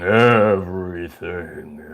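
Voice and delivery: deep, slow, drawn-out monster groan; eerie low otherworldly drone; creepy creature voice. Acted. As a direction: stretch the word like something creeping in. Creepy energy infiltrating everything.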